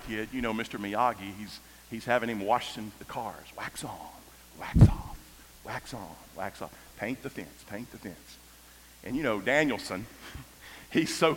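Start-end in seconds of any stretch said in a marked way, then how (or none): 3.6-4.0 soft murmured wax on; quietly quoting Mister Miyagi; hushed quote. Retold or imitated. Quoting Miyagi's instruction softly. 4.6-5.2 breathy whisper; whispered movie line; whispered wax off. Retold or imitated. Quoting Miyagi's instruction. 5.6-8.4 very soft repeated instructions; quietly quoting Mister Miyagi; hushed quote. Retold or imitated.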